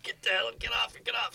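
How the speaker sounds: quietly hustle someone off the stage; hushed urging. Retold or imitated. Stage manager ordering him off, retold.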